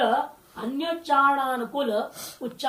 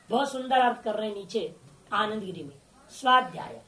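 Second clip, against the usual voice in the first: ordinary delivery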